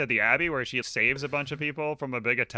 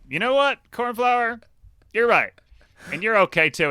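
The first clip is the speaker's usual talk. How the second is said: higher-pitched, lightly acted change of heart; mock dawning confidence; 8 semitones higher, 3 dB louder than usual. Matthias answers Cornflower's pep talk, mock-reassured. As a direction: perk up as the flattered hero, hammed-up.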